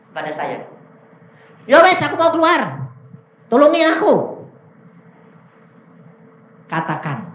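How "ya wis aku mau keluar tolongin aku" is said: loud, high acted plea; retold raised-voice surrender; demon begging. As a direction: cry out for help as the cornered demon.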